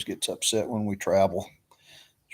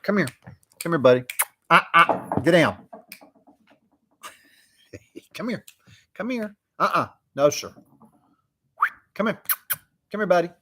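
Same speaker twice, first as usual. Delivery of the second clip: higher, louder sing-song coaxing; coaxing a dog; 8 semitones higher, 3 dB louder than usual. Retold or imitated. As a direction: call the sniffer dog over like a pet. Officer calls the dog over.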